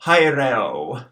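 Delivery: haireo with AE as eye; diphthong demo. Shown as a demonstration. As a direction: model haireo clearly. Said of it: "pronounced haireo".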